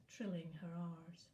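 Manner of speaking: ordinary delivery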